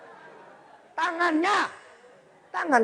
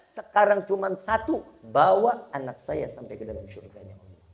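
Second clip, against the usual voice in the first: ordinary delivery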